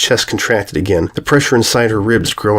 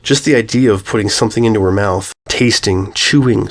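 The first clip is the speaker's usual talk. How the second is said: ordinary delivery, nothing marked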